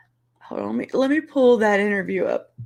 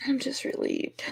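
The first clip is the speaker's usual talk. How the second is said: tearful whisper; 7 semitones higher, 7 dB quieter than usual. Real, not acted. Crying, whispered.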